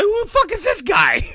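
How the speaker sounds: high-pitched, giggly incredulity; laughing disbelief. Real, not acted. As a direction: squeak it out through laughter. Repeating his baffled line, laughing.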